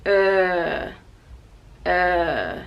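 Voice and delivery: uh held low from the throat; long er sound; long throaty er. Shown as a demonstration. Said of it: "using the throat and just going low".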